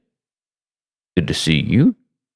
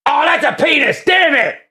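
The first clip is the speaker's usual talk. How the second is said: shocked outburst. Real, not acted. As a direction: caught off guard, shout it out. Spots a rude username.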